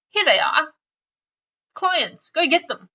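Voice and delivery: mock-generous, high little announcement; pretend helpful giver. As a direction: present an imaginary treasure, playfully. Imagined person handing over clients.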